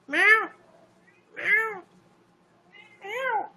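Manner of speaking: high, soft cat meows; a string of high meows; meowing at cat. Pretend. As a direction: meow up at the cat to call it.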